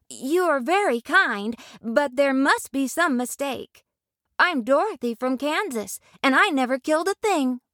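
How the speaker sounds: put-on high girlish voice, earnest; young Dorothy. Dorothy quickly correcting the lady, high and earnest. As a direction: answer promptly and sincerely. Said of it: "said without hesitation".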